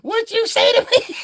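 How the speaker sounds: high-pitched challenge from a wig; talking wig voice. Pretend. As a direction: give the wig its own cartoon voice. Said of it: "got his own voice".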